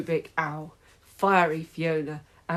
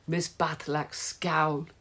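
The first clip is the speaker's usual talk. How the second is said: ordinary delivery, nothing marked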